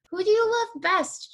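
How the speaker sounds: put-on a touch of a sweet girl. Girl asking her cat at bedtime, lightly voiced and high.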